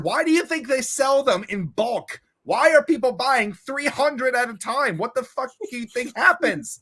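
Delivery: high, slow, spelled-out disbelief; incredulous friend. Retold or imitated. Friend explaining periods in bulk, slow high disbelief. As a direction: explain the obvious to a clueless friend. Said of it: "she also said".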